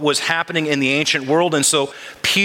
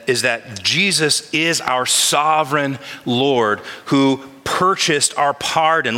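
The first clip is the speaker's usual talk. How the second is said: slow, weighty emphasis; slower than usual. Real, not acted. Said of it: "preacher's emphasis".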